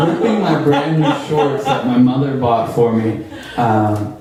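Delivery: usual speaking voice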